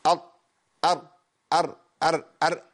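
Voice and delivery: أر repeated with the tongue tip tapping the palate; rolled r demo. Shown as a demonstration. Teacher showing students the r with the tongue tip on the palate, repeated. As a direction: keep saying أر so the tongue beats on the roof. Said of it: "في الراء هقول إيه؟".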